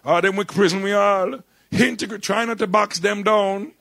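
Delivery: high, loud Jamaican mother scolding; Jamaican mum. Retold or imitated. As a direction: drag out Integrate like a stern Jamaican mum, slow and high. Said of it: "my mum told us to integrate".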